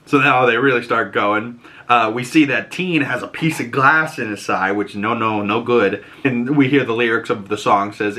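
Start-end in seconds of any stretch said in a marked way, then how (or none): none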